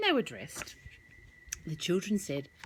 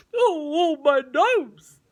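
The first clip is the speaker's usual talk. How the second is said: louder, higher whimpering wail; hurt shark; 7 semitones higher, 10 dB louder, slower than usual. Acted. Shark hit on the nose, louder pained wail. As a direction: wail about your sore nose. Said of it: "said the shark".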